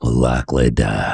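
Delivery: very low Yorkshire film-role voice; Yorkshire accent. Acted. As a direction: drop deep into a Yorkshire accent. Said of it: "the Yorkshire".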